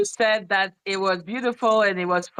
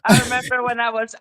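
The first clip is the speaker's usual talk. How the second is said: ordinary talk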